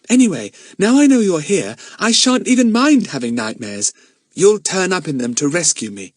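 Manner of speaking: put-on high, loud little-girl voice; trusting, excited girl. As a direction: chirp it confidently to a trusted friend. Lucy Ann's voice.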